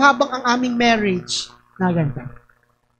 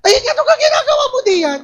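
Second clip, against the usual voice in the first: very high, loud greeting with laughter; loud Batangas voice; 13 semitones higher, 4 dB louder than usual. Shown as a demonstration. Showing how Batangas people talk, high and loud. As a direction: shout it high like a Batangas local. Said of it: "Mataas ang boses".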